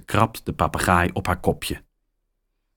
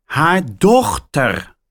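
louder and slower, spelled out; said very loudly; 8 semitones higher, 4 dB louder, slower than usual. A narrator's reading. Mia repeats loudly to the caller. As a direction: say it loud for a deaf caller. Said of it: "zegt Mia heel hard".

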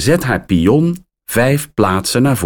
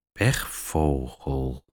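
pechvogel muttered low, soft and slow; mumbled; 8 semitones lower, 9 dB quieter, slower than usual. A narrator's reading. Mia repeating Max's word to herself, soft slow mumble. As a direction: mumble pechvogel under your breath, much softer and lower. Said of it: "mompelt Mia".

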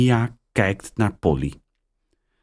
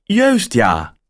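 much higher, louder woman caller; surprised caller on the line; woman's voice; 5 semitones higher, 4 dB louder than usual. A narrator's reading. Caller greets Mia, higher and louder. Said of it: "zegt de stem".

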